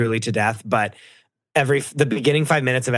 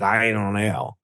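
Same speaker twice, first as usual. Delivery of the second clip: low mock California drawl; California accent; 4 semitones lower, slower than usual. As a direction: parody a lazy Californian accent. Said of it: "is that not his accent?".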